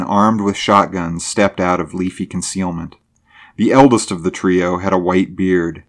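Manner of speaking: plain reading voice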